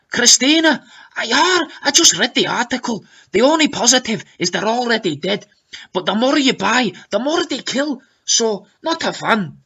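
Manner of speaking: high, lilting fake Scots; exaggerated Scottish, pitched well up; mock-perfected Scottish accent. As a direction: argue back in a put-on Scottish accent. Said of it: "in her newly perfected Scottish accent".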